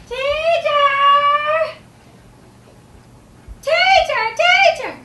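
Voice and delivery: put-on high, loud little-kid holler; calling child. A small pupil calls for the teacher, high loud call. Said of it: "I go and I hear".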